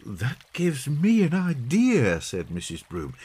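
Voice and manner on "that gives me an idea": high, slow womanly character voice; mother's bright idea. A narrator's reading. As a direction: struck by a happy idea. Mother's bright suggestion in story.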